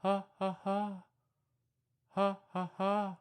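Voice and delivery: ha ha monkey hoots; monkey call back. Pretend. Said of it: "And so you call back".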